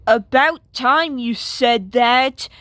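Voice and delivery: usual speaking voice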